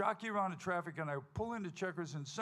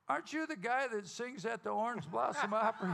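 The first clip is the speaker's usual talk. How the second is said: lady recognizing him; 7 semitones higher, 5 dB louder than usual. Retold or imitated. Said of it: "This lady goes".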